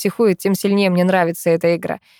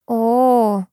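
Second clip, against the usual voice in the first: long, stretched exclamation; drawn-out; 5 semitones higher than usual. A narrator's reading. Girl reacting to news of a bullied boy, drawing it out. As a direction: stretch the vowel in mild sympathy. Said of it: "тянет Люси".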